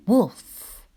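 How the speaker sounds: ordinary delivery, nothing marked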